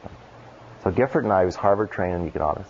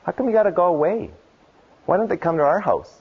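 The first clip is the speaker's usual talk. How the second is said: put-on much higher child voice, a mild plea; little daughter pleading; 8 semitones higher than usual. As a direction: imitate a small kid asking why dad must leave. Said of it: "And I said".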